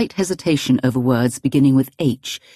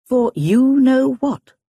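slow, higher, knowing hint; knowing old lady; 8 semitones higher, 3 dB louder, much slower than usual. Acted. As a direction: drag it out knowingly.

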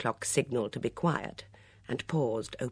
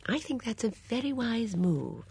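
put-on high, gentle old-lady voice; approving elderly lady, pitched well up; light high voice of an old spinster; Miss Marple; 10 semitones higher than usual. A narrator's reading. Miss Marple answers inspector.

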